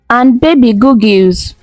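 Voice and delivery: usual speaking voice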